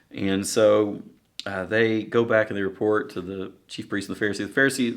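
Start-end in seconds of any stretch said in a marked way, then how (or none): none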